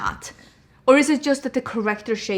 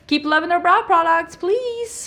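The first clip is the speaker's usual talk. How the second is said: high, laughing mock plea from the brand; pleading brand voice, rising up high; 7 semitones higher than usual. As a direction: beg like a desperate brand, laughing.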